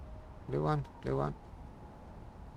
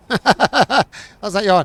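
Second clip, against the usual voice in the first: laughing through the words; chuckling while protesting; 5 semitones higher, 12 dB louder, much slower than usual. Acted. Joking about depression.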